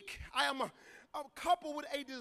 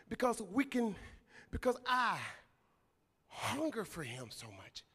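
hushed, earnest preaching; 6 semitones lower than usual. Real, not acted. Preacher confessing desperate hunger for God, hushed and earnest. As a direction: bring it right down after the climax, heartfelt.

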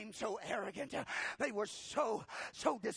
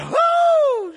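high, loud excited whoo; joyful whoop; 19 dB louder than usual. Acted. Elizabeth feeling the baby jump, whooping. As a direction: let out a delighted whoop. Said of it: "And Elizabeth said".

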